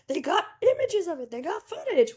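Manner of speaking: high-pitched, gushing crowd reaction; mock public amazement. Podcaster acting out the public's reaction to new footage, mock amazement. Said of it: "Everyone was like".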